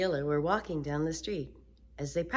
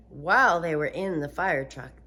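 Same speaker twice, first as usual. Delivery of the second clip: usual speaking voice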